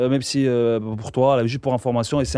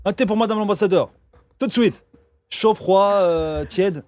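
higher-pitched mock waiter, eager to serve; playful waiter; 6 semitones higher, slower than usual. Host jokingly serving the ambassador tea, mock waiter voice. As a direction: play the fussy café waiter, a touch higher.

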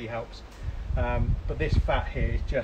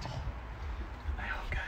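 whispering; 11 dB quieter, much slower than usual. Real, not acted. Quiet aside while checking food.

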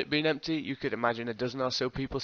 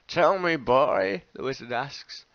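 slow foreign wizard voice; 3 semitones higher, 5 dB louder, slower than usual. Acted.

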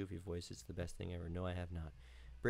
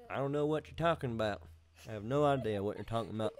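put-on country accent, a bit louder; 4 dB louder than usual. Shown as a demonstration. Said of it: "My country one?".

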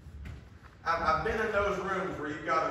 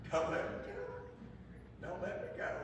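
whispered plea; 9 dB quieter than usual. Retold or imitated. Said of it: "we're gonna scream".

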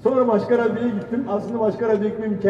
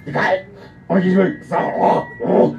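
garbled drill commands; mock army commander; faster than usual. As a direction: bark garbled parade-ground orders. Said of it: "Hareket bu". (anlaşılmaz komutan taklidi)

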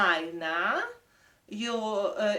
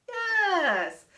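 "Yes" soaring high, louder; delighted praise; 10 semitones higher, 3 dB louder than usual. Real, not acted. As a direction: cheer the right guess. Kids guessed right.

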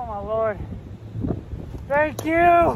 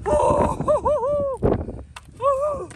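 excited whooping; 14 semitones higher, slower than usual. Real, not acted. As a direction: whoop like you just landed a monster. Whooping over a big catch.

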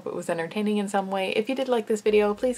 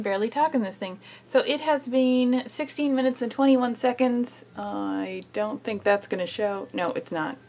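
talking with face covered; through a sheet mask; 2 semitones higher than usual. Real, not acted. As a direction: lips stiff under mask.

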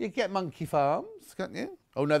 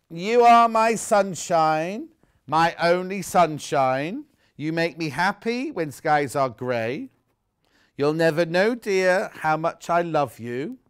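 slow, louder singsong reading; reading to a toddler; 6 dB louder than usual. Acted. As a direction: read it tenderly to a baby. Reads the sunshine book.

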